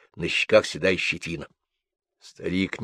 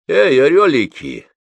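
put-on higher, louder old man calling to the boys; 7 semitones higher, 6 dB louder than usual. A narrator's reading. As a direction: hail the lads from afar. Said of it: "выкрикнул старик". (выкрикнуть громко и высоко)

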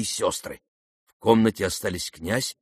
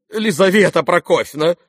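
raised, louder plea by name; appealing for help; 8 semitones higher, 7 dB louder than usual. A narrator's reading. Husband turns to his wife, appealing for help. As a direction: call on her to step in. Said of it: "обратился он к супруге за помощью".

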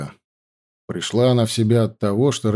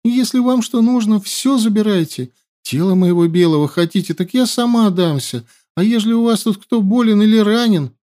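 tearful pleading; 10 semitones higher than usual. A narrator's reading. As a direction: beg through tears. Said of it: "всхлипывая, завела она". (плаксивая мольба)

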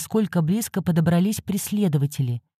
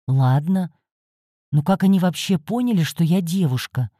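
plain reading voice

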